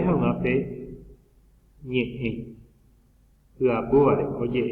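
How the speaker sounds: normal talking voice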